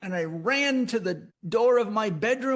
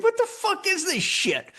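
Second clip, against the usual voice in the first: much higher startled exclamation; shaken outburst; 13 semitones higher than usual. Retold or imitated. Just woken from the dream, a much higher rattled exclamation. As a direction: blurt it out rattled.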